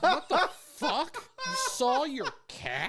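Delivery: high, slow incredulous laugh-through; mock disbelief. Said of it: "he was like".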